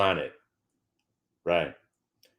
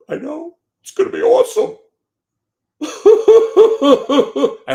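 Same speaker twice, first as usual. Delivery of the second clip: much higher and louder, squealing with glee into a laugh; mock giddy excitement; 12 dB louder, slower than usual. Bearish trader gushing over a hoped-for sell-off, giddy squealing. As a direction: gush like a kid before a holiday. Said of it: "I'm actually getting emotional".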